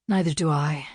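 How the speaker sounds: usual speaking voice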